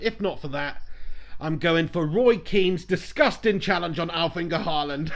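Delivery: ordinary talk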